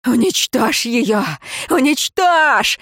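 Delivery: high, frantic mother's shriek; screeching. A narrator's reading. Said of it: "заверещала её мать".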